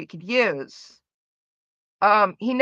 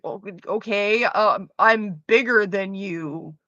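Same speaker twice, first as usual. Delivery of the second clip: slow dumb deadpan; 4 semitones lower, slower than usual. Retold or imitated. Answering the cow insult in a slow, mock-dumb deadpan. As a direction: drag it out very slowly in an unbothered voice.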